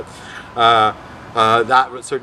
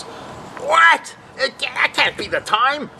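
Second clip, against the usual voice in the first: high-pitched startled outburst; imitated freaked-out boss; 14 semitones higher, slower than usual. Boss spotting the wrong time on a watch, freaked out. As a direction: play a boss in shocked disbelief, pitched way up.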